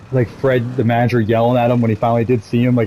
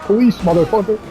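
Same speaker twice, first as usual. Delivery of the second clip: mock cop yell; 8 semitones higher than usual. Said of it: "the police officer was like".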